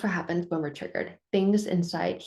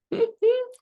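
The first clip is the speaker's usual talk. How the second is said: high, short laugh; brief high-pitched chuckle; 4 dB louder than usual. Real, not acted. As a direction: let out a quick high giggle. Laughs after quoting daughter's question.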